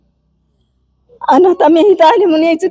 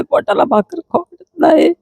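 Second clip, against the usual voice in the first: usual speaking voice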